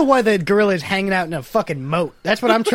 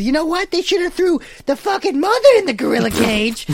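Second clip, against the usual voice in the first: higher, indignant Staten Island lady; Staten Island woman; 7 semitones higher than usual. Retold or imitated. Retelling an overheard lady on the bus, indignant impression. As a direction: rant like an outraged woman on the bus. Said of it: "I heard this lady on the bus".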